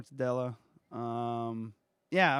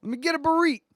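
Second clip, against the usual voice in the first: higher mock ordering voice; mock customer; 11 semitones higher, 4 dB louder, much faster than usual. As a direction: Let me get a burrito, higher and louder, mocking. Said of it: "mock mispronouncing food".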